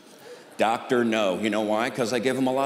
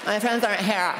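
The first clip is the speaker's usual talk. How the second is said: higher whiny wail; mock distraught daughter; 5 semitones higher, 3 dB quieter than usual. Daughter stuck waiting at the mall, a high whiny wail. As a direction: whine it like a spoiled girl. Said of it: "That's not how she talks".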